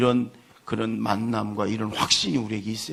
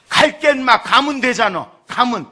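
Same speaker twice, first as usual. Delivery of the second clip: much higher and louder, fed-up surrender; big father yelling that he'll go to church after all; exasperated giving in; 11 semitones higher, 9 dB louder than usual. Retold or imitated. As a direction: cave in loudly like a tough dad who can't take the tears. Father gives in to crying son.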